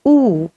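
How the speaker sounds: long oo said by itself; an isolated, held-out oo; vowel demo. Shown as a demonstration. Teacher shows students how the symbol is pronounced, long oo. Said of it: "this is pronounced as oo".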